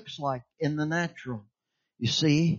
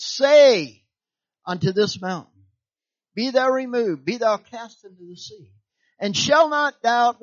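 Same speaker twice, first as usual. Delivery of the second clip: higher and louder, preaching the verse; preached scripture; 5 semitones higher, 6 dB louder than usual. Acted. Preacher quoting Mark to the congregation, preaching. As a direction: proclaim the verse from the pulpit. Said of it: "Mark eleven twenty-three".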